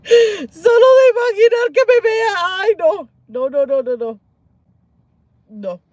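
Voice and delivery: almost crying at the thought; a high wail on the edge of tears; near tears. Real, not acted. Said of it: "hasta casi me pongo a llorar".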